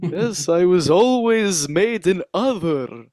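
high, slow lusty vampire; drawn-out theatrical vampire lament; horny vampire voice. Acted. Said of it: "one-man show as the horny vampire".

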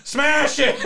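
loud, high-pitched hammed-up yell; comic full-voiced shouting; mock shouting. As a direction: shout it like a riled-up ringleader. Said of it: "just starts shouting".

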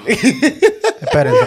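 stretched high through laughter; laughing high drawn-out plea. Real, not acted. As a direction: drag it out high while laughing. Laughing, begs others to wait.

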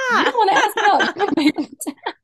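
high, loud mock-bratty complaint, laughing; laughing, half-joking bratty whine; bratty through laughter. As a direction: playfully pout it out while laughing. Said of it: "a little bratty".

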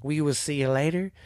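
plain reading voice

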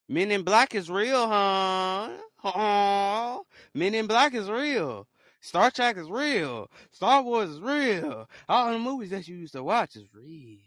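loud, high, stretched-out exclaiming; loud proclamation. Real, not acted. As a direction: holler it slowly in disbelief. Exclaims that movie aliens are real.

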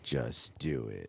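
slow, low and soft slogan; deep slogan voice. Pretend.

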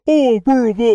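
mock New York Italian. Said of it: "like, New York Italian".

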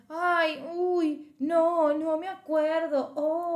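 ay, uy, no, sung high and drawn out like feigning not to remember; mock innocent, singsong "no me acuerdo"; playing dumb. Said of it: "se hace la boluda". (hacerse la boluda, cantando agudo)

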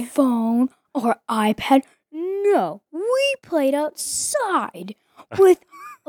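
high, slow, soft dad impression; mock the lecturing parent, drawn out; imitating dad. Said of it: "Is, is that what I sound like?".

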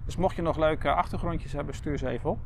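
ordinary delivery

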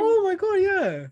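high, loud delighted exclamation; excited. Real, not acted. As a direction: burst out, thrilled to see it. Delighted at seeing the cat.

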